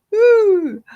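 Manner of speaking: loud high whoop after a laugh; laughing woo. Real, not acted. Celebrating that every day is TGIF, loud laughing whoop.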